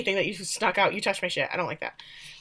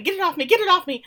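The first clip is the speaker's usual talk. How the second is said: high, fast panicked cry; mock screaming; 11 semitones higher, 5 dB louder, faster than usual. Girl running out of the cabin, mock screaming. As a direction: imitate a frantic, raised-voice shriek. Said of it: "running out of the cabin screaming".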